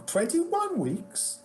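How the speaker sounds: high, drawn-out incredulous echo; appalled disbelief. Retold or imitated. Agent repeats client's tour length, mock appalled. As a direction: mimic an agent outraged at the figure. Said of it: "she was not pleased with me".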